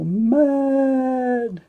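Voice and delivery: surprised cry. Real, not acted. Near-miss in the draw.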